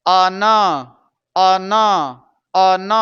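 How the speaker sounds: अन as two even syllables, "uh-nuh"; level, emphatic अन with short open ending; say-along breakdown. Shown as a demonstration. Teacher shortening the word step by step, measured syllables. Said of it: "इसे बोलिएगा मेरे साथ".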